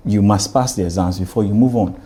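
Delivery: ordinary talk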